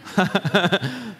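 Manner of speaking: quick burst of laughter at his own joke; a real short laugh after his silly example. Real, not acted. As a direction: laugh it off, amused.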